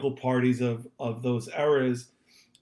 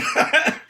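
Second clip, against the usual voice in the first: unvoiced burst of laughter; breathy laugh; 4 dB louder than usual. Real, not acted. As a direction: laugh out in delight. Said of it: "you seem delighted about this".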